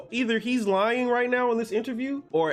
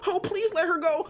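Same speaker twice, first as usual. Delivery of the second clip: mock whiny plea; 8 semitones higher than usual. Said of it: "He wasn't whining".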